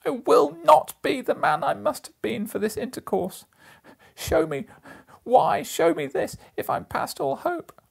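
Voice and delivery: very high, loud pleading cry; desperate plea. A narrator's reading. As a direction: beg for mercy with all your strength. Scrooge pleads for change.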